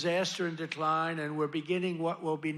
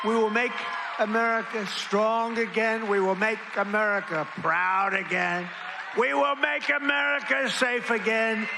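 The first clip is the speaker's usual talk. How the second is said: louder, higher, slowing rally cry; building campaign-rally finale shout; 4 semitones higher, 6 dB louder than usual. Real, not acted. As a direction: roar the closing lines to the crowd. Rally closing chant lines.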